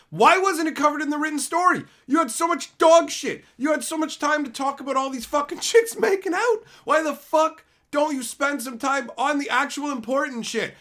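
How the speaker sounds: loud, high, furious yelling at the writers; furious shout. Real, not acted. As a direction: blow up and yell it out in real anger. Ranting at the company's writers.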